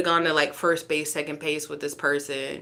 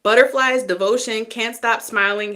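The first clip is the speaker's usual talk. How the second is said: Butterflies burst out higher and louder; excited; 4 semitones higher, 6 dB louder than usual. Real, not acted. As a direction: pop the word Butterflies with delight. Rereads card excitedly.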